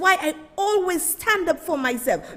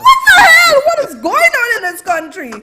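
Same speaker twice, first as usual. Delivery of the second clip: shouted much higher and louder, exasperated; outraged shout; 9 semitones higher, 11 dB louder than usual. Real, not acted. Vlogger shouting in disbelief at the clip, outraged. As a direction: yell it in disbelief.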